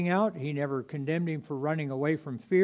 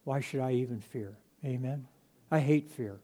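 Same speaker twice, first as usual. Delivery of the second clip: usual speaking voice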